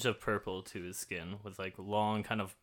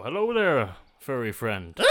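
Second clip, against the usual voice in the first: friendly greeting; 3 semitones higher, 8 dB louder than usual. Acted. Ranger greets a rabbit, loud and jolly. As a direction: greet the bunny with jolly cheer. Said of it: "So I go".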